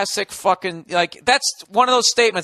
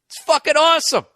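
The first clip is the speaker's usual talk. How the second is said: sarcastic gush; 8 semitones higher, 4 dB louder than usual. Acted.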